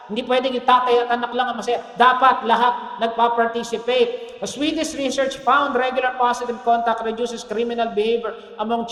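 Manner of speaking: loud, driving sermon voice; full-force preaching. Acted. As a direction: preach it hard to the congregation. Preaching climax.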